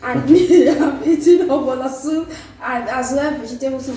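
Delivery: laughing. Real, not acted. Laughing while listing the food.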